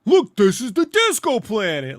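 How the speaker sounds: hammed-up wonder, drawn out; mock announcement. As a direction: present it like a cheesy showpiece. Mocking a show's way of introducing places.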